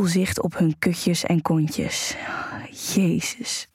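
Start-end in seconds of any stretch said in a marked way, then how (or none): 2.9-3.7 a breathed, voiceless "Jezus"; whispered oath. Acted. Whispered oath while watching.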